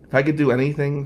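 ordinary delivery

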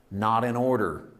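usual speaking voice

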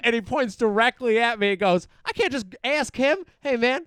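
loud mock old man pleading; hammed-up loud complaint. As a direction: play it big, pointing him out. Old man pointing at speaker.

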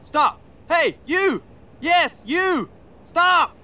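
high shouts after a cab; hailing a taxi. Acted. Brody hails a cab.

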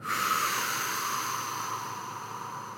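slow, controlled breath out; long exhale. Shown as a demonstration. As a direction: breathe all the way out before releasing the arrow. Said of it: "Right at the bottom of your respiratory".

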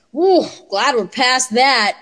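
slow, exaggerated relieved whoop; imitated relief. Son relieved mom has hair now, mock relieved. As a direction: imitate a kid's big drawn-out relief.

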